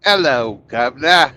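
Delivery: loud mock Cockney hello, governor; mock Cockney greeting. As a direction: bellow it like a stage Englishman. Mocking English accent.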